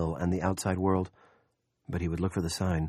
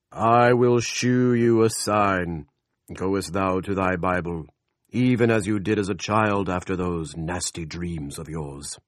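slow booming voice of God; God's voice; 6 dB louder, slower than usual. A narrator's reading. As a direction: proclaim it slow and loud from above. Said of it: "the Lord God said unto Lester".

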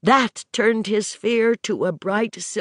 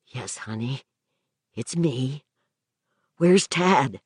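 tender; 7 semitones lower than usual. A narrator's reading. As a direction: comfort her gently. Husband comforting hurt wife.

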